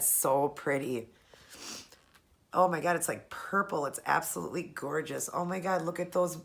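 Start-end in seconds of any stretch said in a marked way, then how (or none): none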